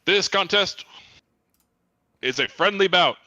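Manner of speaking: booming arena announcer. Retold or imitated. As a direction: announce it to the crowd. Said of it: "It'd be like".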